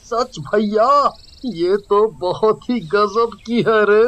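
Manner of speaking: high-pitched goofy sidekick voice; excited high character voice, stretched endings; gawking sidekick. Acted. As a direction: marvel like a smitten stooge. Porter sidekick gawks at a beauty.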